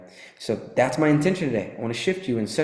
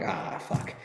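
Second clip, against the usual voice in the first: whispered curse; 6 semitones lower, 8 dB quieter than usual. Retold or imitated. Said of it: "So I'm like".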